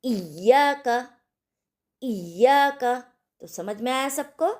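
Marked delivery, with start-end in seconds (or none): 0.0-3.0 इय्याका slow with a pressed, doubled य्य; इय्याका with the य्य held and pressed; stressed doubled ya. Shown as a demonstration. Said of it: "तशदीद को थोड़ा ज़ोर देकर पढ़ेंगे".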